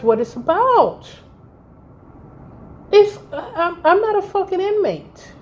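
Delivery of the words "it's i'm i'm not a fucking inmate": indignant. Real, not acted. Outraged at being compared.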